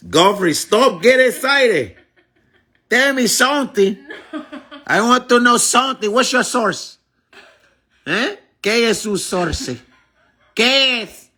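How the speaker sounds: put-on high, excitable Spanish-accented voice. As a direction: slide from fake-accented English into Spanish.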